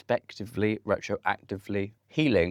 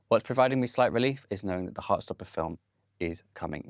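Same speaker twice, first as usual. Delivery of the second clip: ordinary delivery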